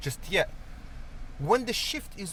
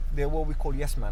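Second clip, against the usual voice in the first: ordinary delivery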